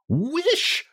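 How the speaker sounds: very high, commanding cry; strong cry. A narrator's reading. Wife commands frightened husband to wish, strong cry. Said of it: "she cried in a strong voice".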